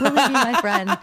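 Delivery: laughing, loud and high. Real, not acted. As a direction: giggle through the question. Laughing at her own challenge.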